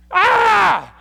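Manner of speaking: very high, loud re-enacted scream; startled yell. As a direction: yell as if jolted awake in fright. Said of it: "Let me yell for a moment. It scared me".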